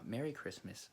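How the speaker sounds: ordinary delivery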